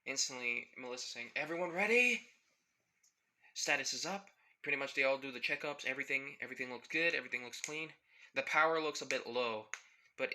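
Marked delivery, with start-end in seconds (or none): 1.4-4.3 loud call. Acted. Melissa starting the time machine.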